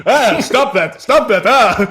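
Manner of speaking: high, loud protesting cries; pained yelp. Acted. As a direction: shout at someone slapping you. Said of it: "He goes".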